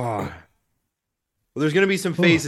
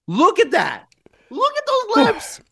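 teasing; slower than usual. Real, not acted. Teasing friend's swollen lips.

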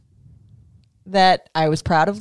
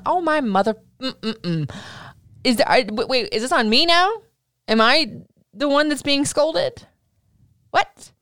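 mock disbelief; 6 semitones higher than usual. Retold disbelief at teacher.

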